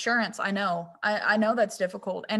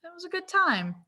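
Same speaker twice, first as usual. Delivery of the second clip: awkward professional; 8 semitones higher than usual. Pretend. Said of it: "if they're equally awkward, they'd be like".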